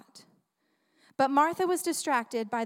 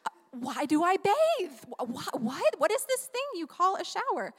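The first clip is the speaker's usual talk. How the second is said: higher, mock-baffled child; imitating kids; 5 semitones higher than usual. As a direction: play the kid who never heard of a bath.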